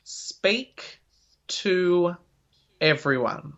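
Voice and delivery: "Speak", "to", "everyone" set apart by pauses; deliberate emphasis. Real, not acted. Teacher giving one key piece of advice, deliberate spaced emphasis. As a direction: space out each word for weight. Emphatic answer to final question.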